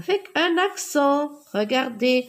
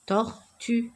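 "Tortue" broken into tor and tue; "Tortue" slowly, one syllable at a time. Shown as a demonstration. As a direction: leave a gap between the two beats of "Tortue". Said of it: "je frappe les syllabes".

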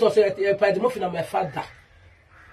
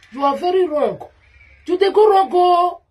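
raised, high-pitched telling-off; scolding; 9 semitones higher, 4 dB louder, slower than usual. Real, not acted. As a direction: scold them, voice climbing high and louder. Scolding someone she says is wrong.

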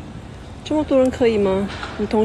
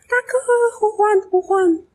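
calling out; 13 semitones higher than usual. Pretend. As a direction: call out to big brother like summoning him from afar. Calling out to absent big brother.

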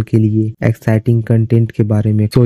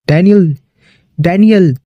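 much higher-pitched name call; acted urgent calling; 8 semitones higher, 3 dB louder than usual. Robin calls to unresponsive Daniel, acted urgent cry. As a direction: call the name out in mounting alarm.

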